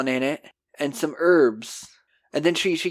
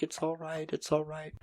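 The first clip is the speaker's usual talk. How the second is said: very soft acted reassurance; mock soothing; 14 dB quieter than usual. [name] to Wayne who feels sick, softly soothing. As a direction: comfort him in a hushed, small voice.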